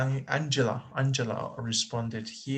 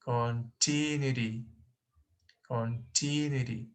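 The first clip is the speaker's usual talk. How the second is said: softer "Continuity", leaning on a long TI; stress on second syllable; 5 dB quieter than usual. Shown as a demonstration. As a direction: quietly test con-TI-nuity. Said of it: "I think it's the second".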